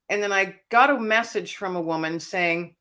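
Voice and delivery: ordinary talk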